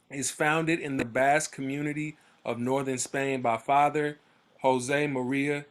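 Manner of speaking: ordinary delivery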